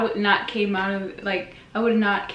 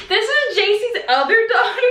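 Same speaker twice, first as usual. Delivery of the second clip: much higher, louder, laughing; mock introduction; 13 semitones higher, 5 dB louder than usual. Relatives introducing her at Christmas, high mock announcement. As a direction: announce her like a party trick. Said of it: "every time we go to a Christmas party".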